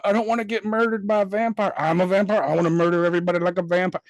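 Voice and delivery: put-on voice mocking a bad actor; exaggerated imitation, starting high and speeding up; mock a character's sudden turn to evil; mock movie vampire. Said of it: "now he's evil".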